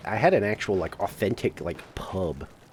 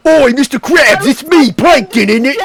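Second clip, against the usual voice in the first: put-on a much higher, louder British accent; loud British Plankton; 10 semitones higher, 8 dB louder than usual. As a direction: bellow it like a cheeky British Plankton.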